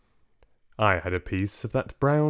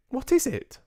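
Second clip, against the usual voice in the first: put-on high, quick small boy's voice; a little mouse boy chattering fast; young boy mouse. Boy mouse tells grandmother of their future, high and quick.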